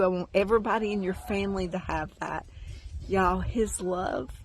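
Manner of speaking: normal talking voice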